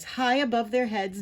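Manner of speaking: plain reading voice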